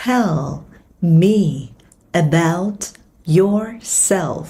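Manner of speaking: slow, each word set apart with pauses; broken into single words. Shown as a demonstration. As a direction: say it word by word for learners. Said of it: "Âm này sẽ là âm E, đánh lưỡi âm L".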